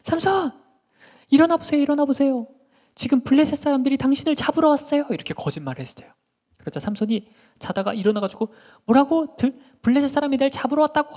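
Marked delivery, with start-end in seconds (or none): 0.0-5.1 high, loud cry to wake a sleeper; acted panicked shout, pitched very high; shouting alarm. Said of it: "들릴라가 딱 소리쳤어요". 8.8-11.2 loud, high startled questioning; acted shocked outburst; startled disbelief. Samson woken by false alarm.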